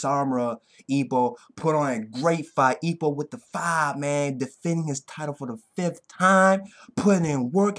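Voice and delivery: ordinary delivery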